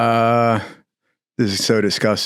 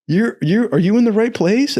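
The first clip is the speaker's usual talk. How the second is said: higher, incredulous question; a stunned bunkmate, much higher; baffled disbelief; 10 semitones higher than usual. Retold or imitated. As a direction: ask like you can't believe he's here.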